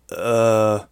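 stammering. A narrator's reading. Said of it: "she stammered".

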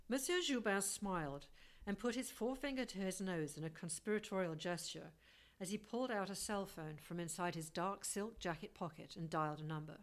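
usual speaking voice

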